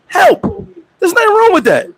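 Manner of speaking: "help" jumps to a very high squeak and falls; sudden squeak. Shown as a demonstration. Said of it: "I'm gonna say it again".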